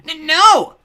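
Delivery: stammered, piercingly high scream of a toad; panicked begging. A narrator's reading. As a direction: shriek for mercy at full volume. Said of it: "the toady begged".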